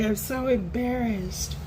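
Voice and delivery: very high, slowed whiny voice; high, slow embarrassed friend; mock embarrassed friend. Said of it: "she was like".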